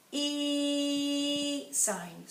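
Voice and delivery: a high, drawn-out E; long E sound. Shown as a demonstration. Teacher showing the class the long E sound. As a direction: stretch the E vowel out high. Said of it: "our long".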